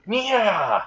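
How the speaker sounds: high drawn-out yell, sliding down; mock pouncing cry; leaping battle cry. Konako jumps over the table at Azazel, battle cry.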